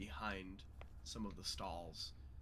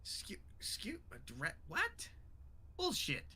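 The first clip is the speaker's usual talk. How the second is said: incredulous goblin; 13 semitones higher, slower than usual. Acted. Goblin hearing the creature was a dragon, high incredulous sputter.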